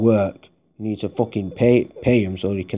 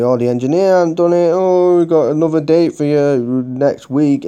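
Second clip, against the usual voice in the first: mock call-centre voice; 6 semitones higher, 7 dB louder than usual. Mimicking call-centre excuses.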